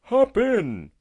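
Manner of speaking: high, loud, cheery call. A narrator's reading. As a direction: call out gaily, pitch leaping up. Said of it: "cried the tortoise gaily".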